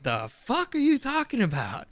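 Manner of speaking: high, drawn-out mock disbelief; incredulous outburst. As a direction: pitch leaps up, stretched out in disbelief. Incredulous retort to overheard opinion.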